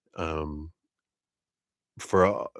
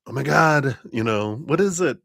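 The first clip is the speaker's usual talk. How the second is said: mock panic; 8 semitones higher, 4 dB louder than usual. Voicing a panicked person.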